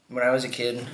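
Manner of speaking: ordinary delivery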